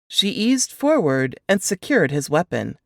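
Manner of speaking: ordinary delivery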